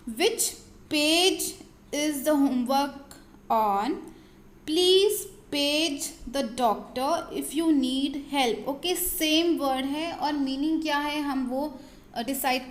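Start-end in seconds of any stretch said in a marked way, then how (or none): 0.1-8.7 very slow, word by word, clearly spaced; slow example reading. Shown as a demonstration. Teacher reading example sentences aloud to students, slow and deliberate. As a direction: read it out slowly for the students to follow. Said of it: "sentence है".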